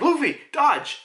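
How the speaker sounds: high-pitched mock warning shout; acted warning call. Acting Sanji's warning to Luffy.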